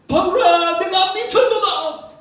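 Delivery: loud, high, slow outcry; Festus shouting. Retold or imitated. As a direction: bellow at Paul like a furious governor. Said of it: "크게 소리내어 이르되".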